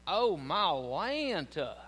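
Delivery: Oh, my Lanta stretched slow and long in a thick Southern drawl; exaggerated Southern drawl. Shown as a demonstration. Preacher hamming up his Southern twang to irk northerners. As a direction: draw out every syllable, Southern. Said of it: "like, eight syllables".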